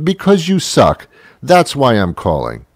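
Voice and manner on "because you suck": angry. Acted. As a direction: fire it back even harder. Repeats the yell at the robot.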